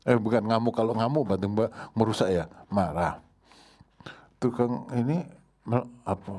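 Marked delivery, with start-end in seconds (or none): none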